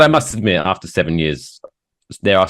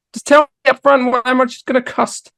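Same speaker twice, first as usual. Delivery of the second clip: high, exasperated squeak of a complaint; a fed-up gripe squeaked high. Real, not acted. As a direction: plead to just be told the price, pitched way up. Griping about added sales tax.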